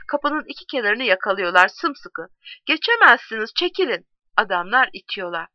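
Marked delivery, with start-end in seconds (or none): 2.7-4.1 high, forceful command; calling out. A narrator's reading. Men holding the doorway against the crowd, calling out. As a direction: order them back in a raised, urgent voice. Men blocking door, ordered back.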